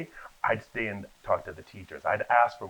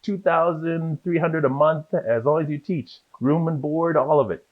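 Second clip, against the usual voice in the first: lightly voiced recruiter, higher and louder; eager recruiter; 6 semitones higher, 7 dB louder than usual. Recruiter calling about a Korea job, lightly acted eagerness. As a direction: rattle off the offer, a hint of keen recruiter.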